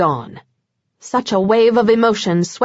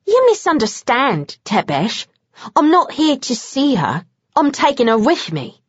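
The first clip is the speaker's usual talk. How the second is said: higher, cool Cockney statement; Bones, cold; 4 semitones higher than usual. A narrator's reading. Bones tells Vlad he's taking Kat, higher and cool. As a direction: state it flat and sure.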